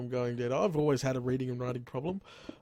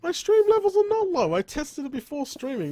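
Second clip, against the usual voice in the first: high, loud fake outrage; mock indignant outburst; 12 semitones higher, 5 dB louder than usual. Streamer answering a viewer's complaint, mock indignant. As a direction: pretend to be deeply offended.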